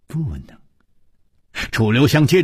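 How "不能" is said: unvoiced whispered reply. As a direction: breathe the answer out, barely a sound. 柳无眉再次勉强回答. (轻声耳语地回答)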